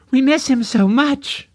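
tearful mother, very high; high-pitched and raspy. A narrator's reading. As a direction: let grief lift the voice high. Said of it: "also speaking with a high-pitched and raspy voice".

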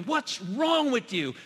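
the Spirit as he does not sound; mock scolding. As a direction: play the sneering judge. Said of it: "doesn't look down on us and going".

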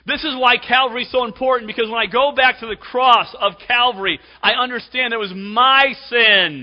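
high, forceful pulpit delivery; full-force preaching. Real, not acted. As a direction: drive it home to the congregation loudly.

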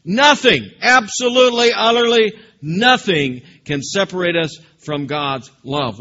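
fervent declaration fading onto love; emphatic proclamation. Acted. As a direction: proclaim it and let it settle. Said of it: "do you see that word?".

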